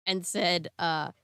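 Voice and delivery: ordinary talk, nothing marked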